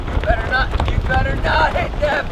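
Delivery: loud high yell; drill sergeant. Real, not acted. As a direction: yell a warning at the recruits. Instructor yelling at recruits.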